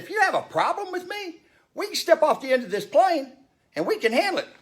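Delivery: retold challenge. Speaker challenging the rude passenger, heated mock threat. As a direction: square up and dare him.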